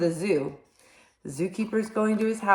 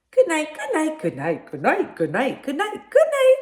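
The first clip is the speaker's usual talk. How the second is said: chorus of animals; 10 semitones higher, 5 dB louder than usual. Acted. Animals chorus goodnights in dark.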